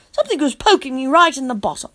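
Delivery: plain reading voice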